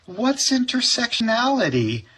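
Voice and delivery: put-on high, puzzled child voice; kid's question. Student asking the teacher a big word, high and puzzled. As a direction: ask it like a confused kid. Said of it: "And then Kyle said".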